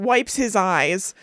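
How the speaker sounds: normal talking voice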